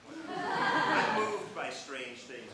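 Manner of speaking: laughing it off, slow and loud. Real, not acted. Host laughing at herself before the audience. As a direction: laugh through a dismissive aside. Laughing self-deprecation after story.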